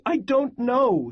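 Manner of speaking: a tearful, choking reply; choked crying. A narrator's reading. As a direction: force the words out through sobs. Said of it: "her voice choked".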